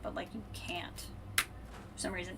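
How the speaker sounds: ordinary talk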